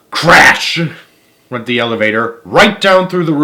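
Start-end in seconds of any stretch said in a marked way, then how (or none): 0.1-0.8 crash sound word. A narrator's reading. Elevator breaking through roof.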